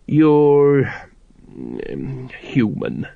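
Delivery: a hint of a hesitant old dwarf; hesitant father. A narrator's reading. A dwarf father hesitantly telling his son the truth. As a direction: pause before the hard word, halting.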